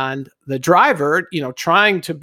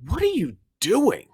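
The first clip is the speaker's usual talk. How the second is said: higher-pitched incredulous question, imitated; baffled question; 6 semitones higher, 4 dB quieter than usual. Trash truck driver to a stranded hitchhiker, imitated bafflement. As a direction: play the puzzled driver, pitch swooping up.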